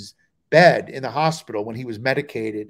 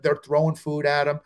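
ordinary delivery

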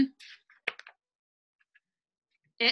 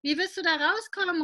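startled father; 6 semitones higher, 3 dB louder than usual. Acted. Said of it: "ruft Papa erschrocken".

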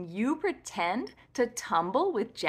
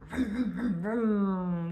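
car vroom; 3 semitones lower, slower than usual. Retold or imitated. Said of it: "goes the car".

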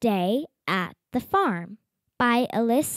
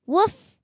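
high, bright little yips of a puppy; puppy woof; 6 semitones higher, 4 dB louder than usual. Acted. Reading a picture book aloud, puppy Biscuit barks with high yips. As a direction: yap it like a happy puppy.

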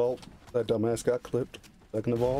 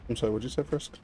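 ordinary talk, nothing marked

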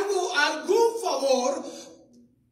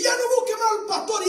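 high, fast nagging; the devil urging someone not to call the pastor, high and fast; devil's taunt; 6 semitones higher, faster than usual. Retold or imitated. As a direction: push the temptation fast and high.

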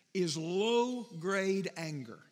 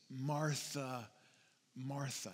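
softer, lower gentle calling of her name; tender; 6 semitones lower, 7 dB quieter, much slower than usual. Acted. Jesus gently calling Martha, softer and lower. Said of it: "He says her name twice".

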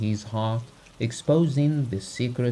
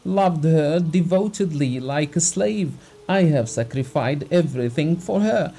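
raised, pleading voice near tears; tearful heartfelt plea; 6 semitones higher, 4 dB louder than usual. Acted. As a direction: pour out the heart, hurt and wronged. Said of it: "With tears in his eyes, trembling all over".

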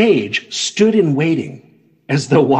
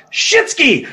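shouted; 11 semitones higher than usual. Acted. Shouted opening line.

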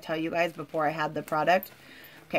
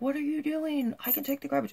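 mock husband scolding; 9 semitones higher, faster than usual. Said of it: "my husband got mad and was like".

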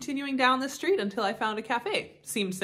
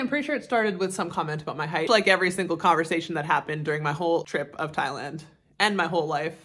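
ordinary talk, nothing marked

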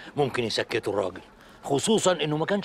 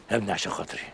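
ordinary talk

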